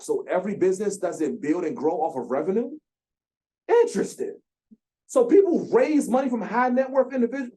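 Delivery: high, loud, incredulous squeal of surprise; mock disbelief. Founder retelling his first lesson on investors, in high, loud mock disbelief. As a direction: play the wide-eyed newcomer just learning rich people write checks. Reliving shock at learning about investors.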